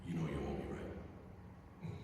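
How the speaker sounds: low, hushed confidential aside; quiet, low retold murmur. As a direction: say it softly, just between two people. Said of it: "Very quietly, didn't say a word else".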